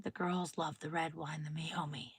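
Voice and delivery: conspiratorial whisper; whispered wine tip. Real, not acted. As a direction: let them in on a secret. Secret wine tip again.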